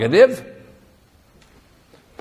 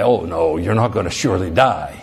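much lower, sly tempter; low serpent voice; 11 semitones lower than usual. Acted. Serpent contradicting God to the woman, low and sly. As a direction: purr the lie low. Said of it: "he lured her with this question".

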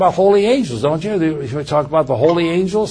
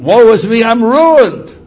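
anguished cry; 6 semitones higher, 9 dB louder than usual. Acted. Preacher voicing Isaiah before God, a loud anguished lament. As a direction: cry out woe with full force. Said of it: "And why is he ruined?".

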